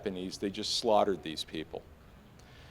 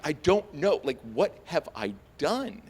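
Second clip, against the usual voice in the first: higher-pitched, baffled protest; retold panic, pitched up; acted bewilderment; 6 semitones higher than usual. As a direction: act out helpless confusion. Acts out bewilderment under arrest.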